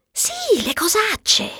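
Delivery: very high, soft, coy yes; hushed, high-pitched naughty admission; coy confirmation. Acted. Confirming the euphemism coyly.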